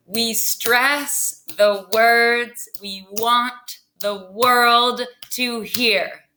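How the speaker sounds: very slow, leaning on "stress", "words", "want", "world", "hear"; stressed iambic motto. Shown as a demonstration. Teacher giving the class a motto, "stress", "words", "want", "world", "hear" stressed. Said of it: "were emphasized with the stress".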